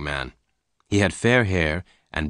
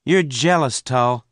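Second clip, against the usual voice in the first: put-on higher-pitched young man's taunt; 6 semitones higher, 3 dB louder than usual. A narrator's reading. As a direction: needle your rival with a raised pitch. Venters taunts his captor.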